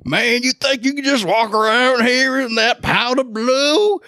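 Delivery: high, slow jeering fan voice; mock-tough fan voice; taunting Alabama fan. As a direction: razz the visitor like a local fan. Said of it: "first they'd go".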